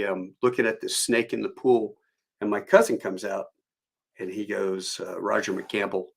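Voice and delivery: ordinary delivery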